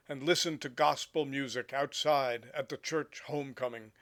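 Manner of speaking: measured poetry reading, projected above chatting level; poem recitation. Acted. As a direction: read the poem out phrase by phrase, with pauses between images. Reads a poem aloud.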